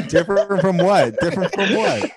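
high laughing question; laughing disbelief. Real, not acted. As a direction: laugh through the words.

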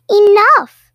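high, loud furious cry; angry shout. Acted. Angry villagers having had enough, yelling. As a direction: scream it like a fed-up crowd.

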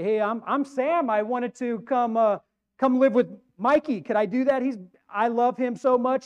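a starstruck fan begging to move in; gushing superfan. Acted. Preacher acts gushing superfan at gate.

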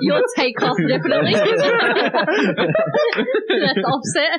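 laughing through the words; giggling while talking. Real, not acted. Group joking about [name].

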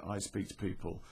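normal talking voice

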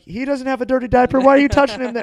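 high, loud hammed-up outcry; mock accusing shout. Joking brothers shouting about the toddler, mock outcry. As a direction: shout it so the neighbors hear. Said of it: "or just be like".

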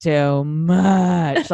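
put-on very slow, drawn-out drunk wail; mock drunken crying. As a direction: imitate a crying drunk blaming a friend. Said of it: "Like that the whole time, just crying".

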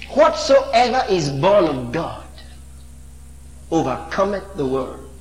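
solemn reading of scripture; scripture recitation. Acted. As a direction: intone the verse. Recites scripture over music.

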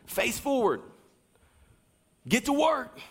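short punchy coach commands. Real, not acted. Preacher pushing the congregation like a coach. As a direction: fire them up like a coach at halftime. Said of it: "This is like the coach coming out of me".

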